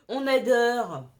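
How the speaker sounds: slow laughing on adore. Real, not acted. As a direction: giggle it out, slowly. Laughs over a colour.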